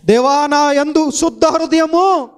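high, loud climactic preaching; preaching at full force. Acted. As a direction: build the sermon to a ringing peak. (ఉద్రేకంతో బోధించే స్వరం)

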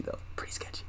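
hushed aside, unvoiced; whispering. Real, not acted. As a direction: breathe the words out in a whisper. Whispered aside after story.

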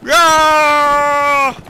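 high, loud charging yell; a short burst of a charge; battle yell. Pretend. Rider charging the enemy line, loud yell.

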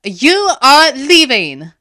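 slow, high, loud shout; furious bellow. A narrator's reading. Fern banishing the tiger, furious bellow. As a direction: bellow each word with force. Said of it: "she bellowed".

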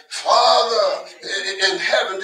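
drawn-out, loud "Father", overly grand; mock formal prayer. Preacher showing congregation how they needn't pray, mock grand prayer. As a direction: intone it like a showy public prayer. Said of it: "you don't have to pray like".

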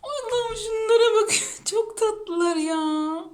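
high, loud coo easing into a slow drawl; adoring gush. Real, not acted. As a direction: gush over cute pets, stretching words. Gushing over cute dogs. (bayılarak, uzata uzata)

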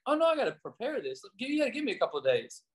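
high, quick, dismissive mock brush-off; brushing him off. Pastor retelling putting Randall off, high quick dismissive brush-off. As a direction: wave him away, too busy. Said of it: "I was like".

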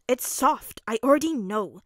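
put-on loud, put-out little boy's grumble; grumbling. A narrator's reading. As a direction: grumble it with indignant force. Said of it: "he grumbled".

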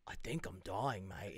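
low, slow, soft mock Australian; dying Australian bandmate. Matt in the biopic, dying, low Australian voice. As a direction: sink into a feeble Australian confession.

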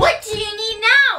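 hammed-up impatience; exasperated. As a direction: act fed up at yet another request. Host snaps at needy guest.

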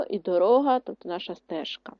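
normal talking voice

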